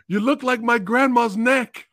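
high, loud crab voice. Retold or imitated. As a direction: squeal it like a sassy crab. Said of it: "he was like".

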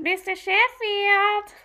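high, slow, drawn-out sitcom nanny call; Fran Drescher. Retold or imitated. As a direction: stretch out the name like a sitcom nanny. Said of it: "the lady from The Nanny, Fran Drescher".

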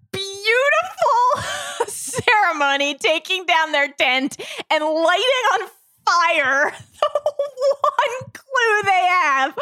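high, slow, laughing through the words; laugh-cry in disbelief at the stranger; laughing outrage. Real, not acted. Podcast host laughing at the stranger's blunder, real laughter.

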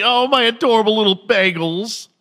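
high, doting coo; mock Jill Biden. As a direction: gush over them like adorable pets.

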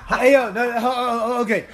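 ordinary talk, nothing marked

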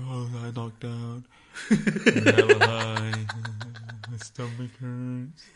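slow, dragged-out imitation with a laugh; mock lazy texter. Girlfriend mocking her boyfriend's lazy texts, slow and drawling. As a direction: mimic a boyfriend's lame excuses, slowly. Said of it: "and you be like".

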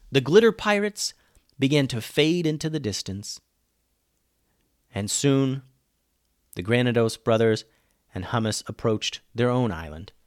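usual speaking voice

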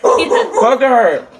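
high, laughing call of Booger; high laughing pet call; calling pet's name. Real, not acted.

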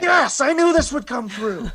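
hammed-up triumph at the imagined offer; mock gloating. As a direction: gloat over the win. Gloating over imagined presidency.

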